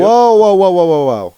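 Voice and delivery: loud, astonished whoas; surprised whoa. Real, not acted. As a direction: cry out in surprise at the screen. Surprised at new weapon pick.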